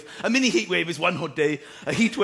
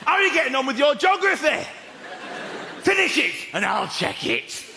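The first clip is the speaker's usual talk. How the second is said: stern dad; 6 semitones higher than usual. Retold or imitated. Dad checking on son's geography, stern. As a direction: quiz him then sign off. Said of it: "So he goes".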